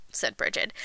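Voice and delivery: ordinary delivery, nothing marked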